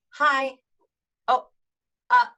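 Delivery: high, halting false starts; awkward video-call fumbling; awkward hesitation. Acted. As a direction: stammer a greeting with long gaps, unsure who leads. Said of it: "most awkwardness happens on video calls".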